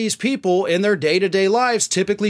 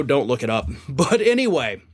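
usual speaking voice

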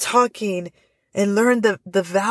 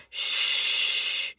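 soft unvoiced shh; shushing; 7 dB quieter than usual. Shown as a demonstration. Teacher hushing the class, long shh. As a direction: hush them gently. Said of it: "everybody quiets down".

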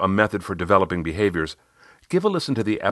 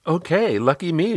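much higher client voice; pitched-up client taking the praise; Riley; 10 semitones higher than usual. Retold or imitated. Client accepting praise from her coach, high voice. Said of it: "she said".

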